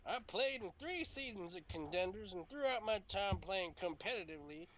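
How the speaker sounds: high, lilting southern voice; southern accent. Acted. Continues southern accent reading.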